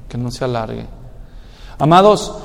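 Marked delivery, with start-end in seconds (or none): 0.1-0.9 much softer, lower echo; almost inaudible. Real, not acted. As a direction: let the repeat fall to a hush. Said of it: "repeated phrase".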